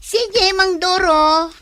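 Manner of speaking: loud, high, drawn-out call; stretched-out farewell shout. Acted. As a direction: call after someone leaving, slowly. Calling after departing Mang Doro.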